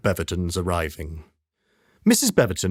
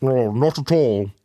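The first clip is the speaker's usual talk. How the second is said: muffled; 4 semitones higher than usual. Acted. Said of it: "came the muffled voice".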